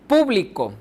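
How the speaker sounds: público with a high PÚ, falling away; stress on first syllable. Shown as a demonstration. Teacher showing stress at the beginning, hitting PÚ in público. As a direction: punch the PÚ of público. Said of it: "la sílaba tónica está al principio".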